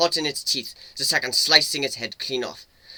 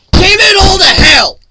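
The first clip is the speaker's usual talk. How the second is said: very loud high raging yell; furious shout; 18 dB louder than usual. Acted. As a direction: explode with grief and rage. Slamming fist after a death.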